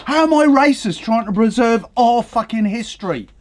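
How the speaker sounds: usual speaking voice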